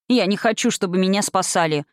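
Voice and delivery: usual speaking voice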